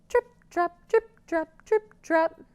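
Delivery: high trip trap; hoof chant. Shown as a demonstration. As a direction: tap it like hooves. Goat crosses.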